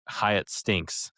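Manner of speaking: ordinary talk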